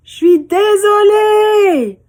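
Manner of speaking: high, slow, loud apology; remorseful child. Acted. Girl calling out her apology to the echo. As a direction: plead sorry like a little girl. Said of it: "Alors, elle dit".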